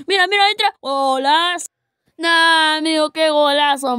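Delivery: high excited goal call; breakneck goal commentary. Real, not acted. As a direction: shout the goal.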